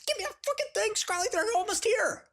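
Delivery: high, panicked hiss then voice; mock panic, high and hushed; frantic whisper. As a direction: whisper urgently as guards approach. Hiding phone from guards.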